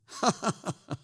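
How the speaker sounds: high jeering laugh; scornful crowd laughter; mocking laugh. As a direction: laugh at the fool. Scoffers laugh at Noah.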